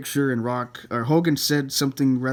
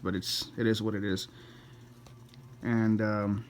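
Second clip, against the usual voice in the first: ordinary talk, nothing marked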